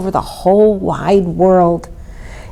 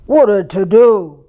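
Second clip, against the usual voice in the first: booming ox; 4 dB louder than usual. A narrator's reading. Ox remarking on the commotion, booming. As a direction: boom it like an ox. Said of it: "boomed Ox".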